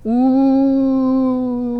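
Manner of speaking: long spooky ghost moan; haunting ooh like a ghost. Pretend. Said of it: "Practicing my ghost impression".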